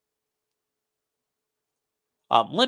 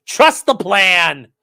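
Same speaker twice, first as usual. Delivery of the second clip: slow, louder mock slogan; mock proclamation; 5 semitones higher, 7 dB louder, much slower than usual. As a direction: drag it out like a grand prophecy. Mocking conspiracy slogan.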